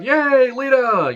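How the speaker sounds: mock the crowd cheering her name; stretched-out fan cheer. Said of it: "the crowd".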